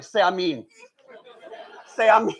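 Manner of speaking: flat, demanding order; blunt command. Retold or imitated. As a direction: tell them bluntly to say it. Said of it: "It's very blunt".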